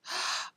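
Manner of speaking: quick gasp of discovery; excited gasp. A narrator's reading. Billy spots the loose pig, excited gasp. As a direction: catch your breath at the sight.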